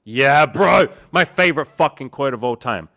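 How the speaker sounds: loud, high burst of agreement; hyped friend loudly agreeing about his favorite quote; excited. Real, not acted. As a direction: shout your approval.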